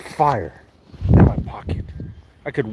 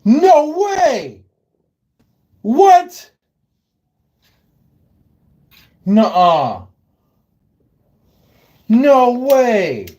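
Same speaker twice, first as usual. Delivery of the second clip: louder and much higher, stunned; can't believe what he sees; real disbelief; 8 semitones higher, 6 dB louder, much slower than usual. Real, not acted. Finding the lamp oil frozen, loud disbelief.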